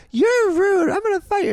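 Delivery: high squeaky imitation of a threatening drunk; mock angry stranger. Friend mimics the stranger threatening to fight, high and squeaky. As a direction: caricature his aggressive threat, high and loud.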